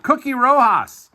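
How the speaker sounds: Cookie Rojas called out high and bright; excited name call. Real, not acted. Opening a new autograph return, excited call. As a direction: announce the name with a thrill.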